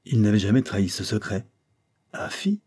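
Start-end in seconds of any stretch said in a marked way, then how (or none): 2.1-2.6 very high, soft, taken aback; stammering surprise. A narrator's reading. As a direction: falter on it, astonished, pitch shooting up. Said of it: "bégaya-t-il". (balbutiement étonné, aigu et faible)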